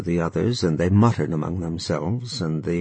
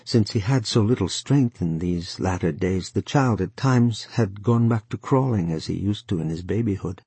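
ordinary delivery

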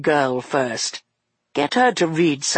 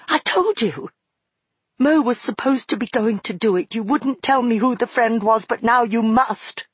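put-on high, urgent girl voice; anxious girl; 8 semitones higher than usual. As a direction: rush the worry out.

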